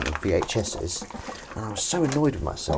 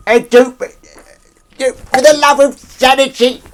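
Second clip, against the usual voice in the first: loud, very high mock outburst; shrieking falsetto; 13 dB louder than usual. Host hamming up the cat-voice bit, shrieking falsetto. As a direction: squeal it in exasperation, way up high.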